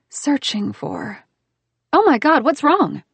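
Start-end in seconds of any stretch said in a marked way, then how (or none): 1.9-3.0 high, loud sudden alarm; startled, rush to ask what's wrong; sudden worried outburst; alarmed cry. Acted. She sees his scowl, alarmed.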